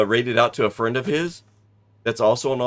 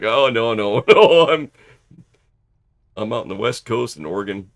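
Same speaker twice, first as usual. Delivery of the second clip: laughing denial; 7 dB louder than usual. Retold or imitated. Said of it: "I'm like".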